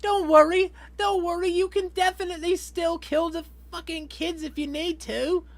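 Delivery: high, loud mock British voice; a cheery upper-class Brit reassuring the cops; mock British official, high pitched; British accent. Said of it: "I don't know why I made that guy British".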